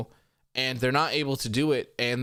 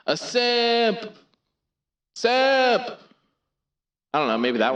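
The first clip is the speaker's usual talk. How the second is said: much higher, louder megaphone shout; loud tinny megaphone Simp; 12 semitones higher, 5 dB louder, slower than usual. Shown as a demonstration. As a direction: blast it through a megaphone. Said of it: "Six is Megaphone".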